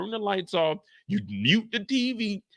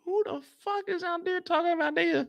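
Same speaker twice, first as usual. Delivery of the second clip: high hushed grumble; 9 semitones higher, 5 dB quieter than usual. Acted. Neighbor peeking and grumbling.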